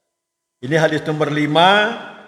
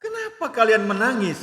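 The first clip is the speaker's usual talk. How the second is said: higher-pitched questioning of the Prophet; retold questioning; 6 semitones higher than usual. Sa'ad asks the Prophet why he weeps, retold questioning. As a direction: put the question in a raised voice, as retold. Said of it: "Sa'ad bertanya kepada Rasulullah".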